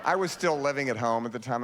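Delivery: ordinary talk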